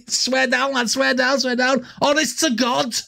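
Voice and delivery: mock Scouser insisting he's honest; Scouse impression. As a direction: swear blind in a Liverpool accent. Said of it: "Like that".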